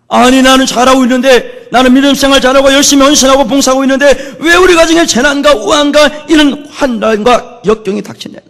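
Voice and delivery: high, loud, acted-out protest; resentful complaint. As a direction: complain to God as a wronged believer. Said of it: "그래서 불평하기도 하고요".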